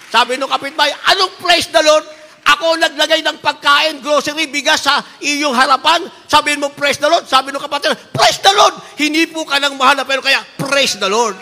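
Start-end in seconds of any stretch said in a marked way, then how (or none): 1.1-7.3 higher, louder indignant scolding; a neighbour scolding the believer, loud and high; angry neighbour. Retold or imitated. 8.1-11.4 much higher, much louder joyful cry; answering the neighbour with a loud joyful shout; shouted praise. Retold or imitated. Believer shouts back praise.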